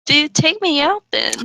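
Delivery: usual speaking voice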